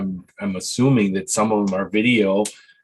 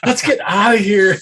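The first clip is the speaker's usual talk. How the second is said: high-pitched play-acted escape cry; mock panicked getaway; 9 semitones higher, 4 dB louder than usual. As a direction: act out fleeing the scene, pitched way up. Acting out a squealing-tires getaway.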